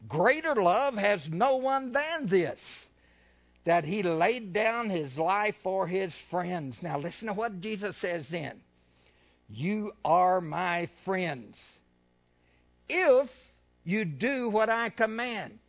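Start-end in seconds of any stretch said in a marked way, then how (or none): 0.1-6.8 loud, slow scripture proclamation; forceful preaching. Acted. 9.5-15.6 loud, slow scripture proclamation; forceful preaching. Acted.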